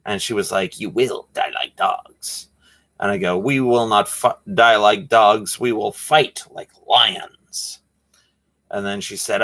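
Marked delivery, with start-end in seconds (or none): 0.8-2.5 slow, lightly voiced menace; a hint of a bandit's threat, slowed; movie villain line. Clerk voiced as Three Amigos bandit, slow mild menace. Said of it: "those are lines from one of my favorite movies". 3.4-7.8 slow, lightly hammed-up defiance; heroic movie line. Answering the clerk with a Three Amigos line, slow mock defiance. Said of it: "those are lines from one of my favorite movies".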